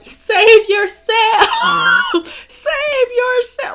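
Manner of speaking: screaming high and loud, hammed-up; mock desperate shout. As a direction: yell it like a drunk dying hero. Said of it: "I literally looked at my brother and was like".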